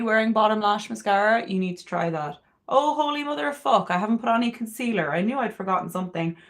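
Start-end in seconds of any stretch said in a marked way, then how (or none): none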